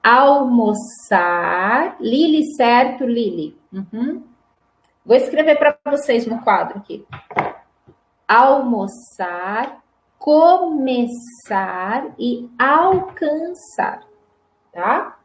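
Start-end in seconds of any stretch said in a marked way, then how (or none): 0.0-2.0 almoçar slowly, with a hissed s for the ç; som de S. Shown as a demonstration. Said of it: "todos som de S". 8.3-14.0 almoçar, começar, alcançar slowly, syllable by syllable; som de S. Shown as a demonstration. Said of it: "todos som de S".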